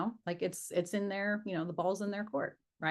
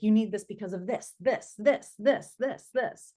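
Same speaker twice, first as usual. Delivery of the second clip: rapid fire; 3 semitones higher, 4 dB louder than usual. Acted. Coach imitating sellers straining to convince, rapid fire. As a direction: fire off each reason like a pushy seller. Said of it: "They rapid fire".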